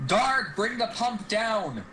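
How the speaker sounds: high, drawn-out bellowed order; long, high-pitched commanding yell; captain's roar. Acted. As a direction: roar it slowly across the deck. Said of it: "Captain roars".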